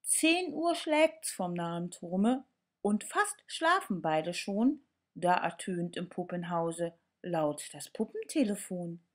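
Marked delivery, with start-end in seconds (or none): none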